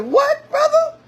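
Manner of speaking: high, indignant squeal of a wife; mock outraged sister. Sister snapping back at her husband, high and outraged. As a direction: play the sister, shocked and offended. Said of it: "that sister said".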